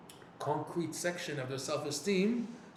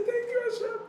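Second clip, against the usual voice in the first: very high, excited cry of thanks; overjoyed thanks to God; elated thanks; 3 dB louder than usual. Retold or imitated. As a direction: squeal it out with relief. Relieved thanks after release.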